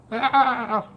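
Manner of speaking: high startled cry; mock panicked yelp. Imitating panicked reaction.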